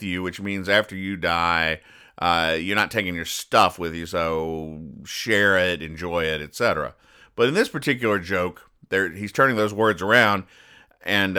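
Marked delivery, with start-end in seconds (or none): none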